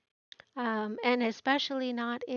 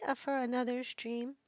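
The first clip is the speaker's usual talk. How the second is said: ordinary talk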